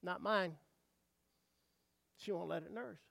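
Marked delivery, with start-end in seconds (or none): 0.0-0.6 hushed, dismissive little refusal; lightly voiced unwilling mama cow; mama cow refusing. Mama cow turned away from the orphan calf, quiet refusal. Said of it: "and she go".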